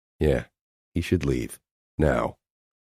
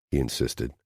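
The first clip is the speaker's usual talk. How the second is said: ordinary delivery, nothing marked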